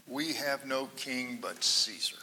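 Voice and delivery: whispered answer, soft and breathy; hushed whisper. Retold or imitated. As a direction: whisper it low and cold. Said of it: "The chief priest answers".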